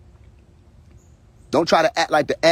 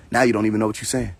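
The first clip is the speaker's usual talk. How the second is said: usual speaking voice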